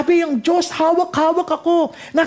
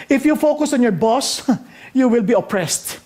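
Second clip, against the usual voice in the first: ordinary talk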